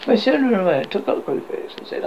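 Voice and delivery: usual speaking voice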